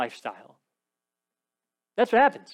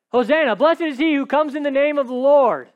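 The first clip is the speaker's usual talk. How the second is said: crowd crying out; 8 semitones higher, 6 dB louder than usual. Acted. Crowd crying out at triumphal entry.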